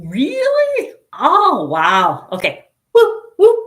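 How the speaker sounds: high, loud astonished cheer; amazed whoop. Real, not acted. Amazed at chat comment.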